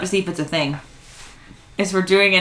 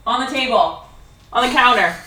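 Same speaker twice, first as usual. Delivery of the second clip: higher-pitched call across rooms; raised, sing-out request; 6 semitones higher than usual. Real, not acted. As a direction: ask a favour of someone out of the room. Asking Adam in another room.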